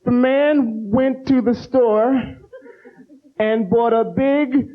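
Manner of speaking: very slow halting read; sounding out words. Retold or imitated. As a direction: read like a poor reader stalling. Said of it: "It'd be like".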